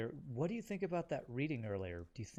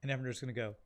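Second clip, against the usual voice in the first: usual speaking voice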